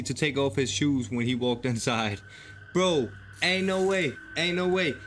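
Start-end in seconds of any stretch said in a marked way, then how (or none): none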